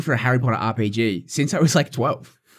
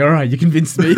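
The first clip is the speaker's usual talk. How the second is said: high, louder, sing-song surrender; mock giving in; 6 semitones higher, 8 dB louder than usual. Mocking his cohost caving to his friend, in a high giddy voice. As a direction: cave in theatrically.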